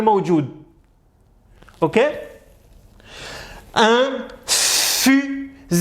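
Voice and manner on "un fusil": fusil said very slowly as "fyzi", the L silent; teacher modelling fusil, L silent; correct pronunciation. Shown as a demonstration. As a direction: drag out Un fusil with no final L. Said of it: "Tu vas pas dire un fusil".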